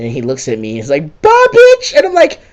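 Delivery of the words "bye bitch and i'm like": very high, loud squealed taunt; mock taunting shout. Villain taunting the player as he leaves, squealed. Alden's parting taunt acted out.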